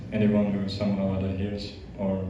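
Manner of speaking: normal talking voice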